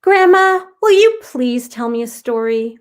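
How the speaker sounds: put-on a little girl pleading sweetly; pleading child. Girl begging Grandma for a story, high and pleading. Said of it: "Aubrey pleaded".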